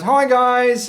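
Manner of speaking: very high, loud little first mate; Perla, chirpy greeting. Acted. First mate greeting the passengers, high and chirpy. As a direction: squeal a greeting in a tiny high voice. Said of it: "She goes".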